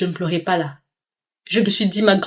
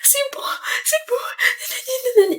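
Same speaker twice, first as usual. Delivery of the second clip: fake crying plea; 10 semitones higher, slower than usual. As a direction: beg dad through fake tears. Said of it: "je suis là en train de crier".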